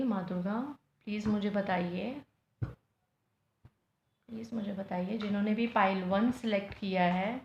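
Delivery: soft, low, hushed plea; murmured prayer. Real, not acted. Tarot reader asking the goddesses for guidance while shuffling, hushed prayer. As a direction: pray quietly, almost under your breath.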